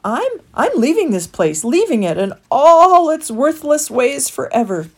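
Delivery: a rat fighting back tears to leave forever; tight with emotion. Acted. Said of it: "The rat's voice was tight with emotion".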